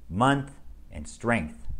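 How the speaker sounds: "month" and "strength" said slowly, each final th drawn out; "month, and strength" spoken slowly, with the final th of each word held between the teeth; exaggerated th practice. Shown as a demonstration. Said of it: "words that have the T H sound in the middle or end".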